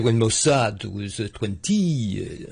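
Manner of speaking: slow imitation of an urbane French accent; a slow urbane French accent, lightly imitated. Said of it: "his incredibly urbane French accent".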